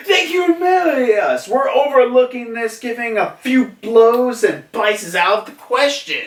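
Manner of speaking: high, hammed-up outraged outburst; indignant protest. Retold comrades' indignant protest.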